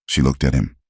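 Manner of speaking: ordinary delivery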